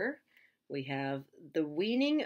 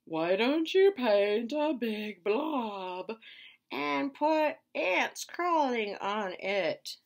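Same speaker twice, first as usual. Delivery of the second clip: slow, high, loud alien; very slow, high, loud alien message; 7 semitones higher, 7 dB louder, much slower than usual. Retold or imitated. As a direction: drone it out like a space alien. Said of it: "he gets messages through his mustache".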